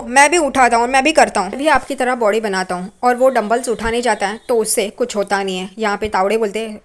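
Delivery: ordinary delivery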